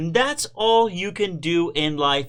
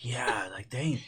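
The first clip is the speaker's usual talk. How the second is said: whispered dang; 4 semitones lower, 10 dB quieter, slower than usual. Real, not acted. Reacting to boring editing.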